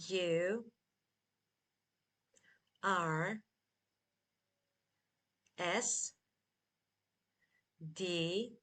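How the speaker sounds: very long gaps between single letters; spelled slowly. Shown as a demonstration. Teacher spells Thursday together with learners, very slowly. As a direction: spell very slowly, waiting after every letter. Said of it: "Again, slowly".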